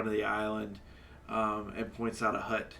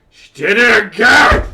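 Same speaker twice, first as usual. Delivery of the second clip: loud, higher accusing yell; angry shout; 11 semitones higher, 22 dB louder than usual. Acted. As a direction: bellow it across the bar. Tavern patron yelling accusation.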